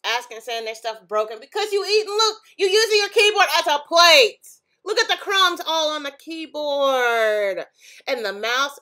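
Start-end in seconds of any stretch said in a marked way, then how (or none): 1.4-7.6 loud, high, incredulous rant; exasperated scolding. Real, not acted. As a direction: keep ranting, appalled. Scolding continues.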